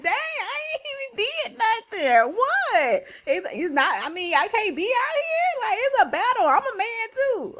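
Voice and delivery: high, loud, whiny little-brother complaint; comically outraged younger sibling defending himself; David's mock protest. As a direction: squeal it in playful indignation. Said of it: "He's like".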